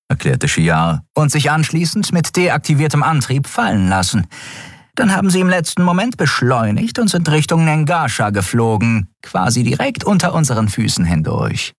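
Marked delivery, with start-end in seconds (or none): 1.1-11.7 high-voiced officer briskly reporting to the admiral; urgent, high report to the commanding officer; first officer reporting. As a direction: pitch her voice up as a crisp officer giving a report. Shia continues her report.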